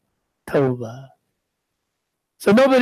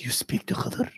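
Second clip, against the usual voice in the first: whispered in disbelief, much softer; stunned whisper; 11 dB quieter than usual. Retold or imitated. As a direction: breathe it out, astonished and hushed. Shaykh stunned by child's claim.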